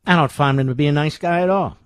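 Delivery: normal talking voice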